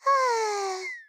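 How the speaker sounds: long, drawn-out whiny sigh; exasperated sigh. Acted. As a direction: heave a big put-upon sigh. Exasperated at being refused.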